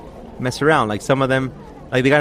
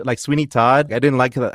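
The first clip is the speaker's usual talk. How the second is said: ordinary talk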